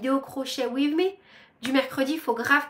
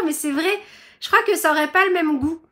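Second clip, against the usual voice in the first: ordinary talk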